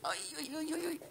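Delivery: high squeaky ui ui; squeaky cooing. Real, not acted. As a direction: coo up high at the pet. Cooing at pet.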